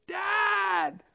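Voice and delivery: high, loud call across the crowd; a son calling out to his father from the crowd; piercing shout. Retold or imitated. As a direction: yell Dad to catch his attention. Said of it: "the piercing voice of his son".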